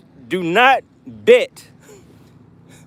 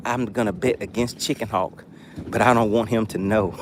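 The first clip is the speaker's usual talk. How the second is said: secretive quote; 9 semitones lower, 8 dB quieter than usual. Retold or imitated. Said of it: "He sent me a private text and said".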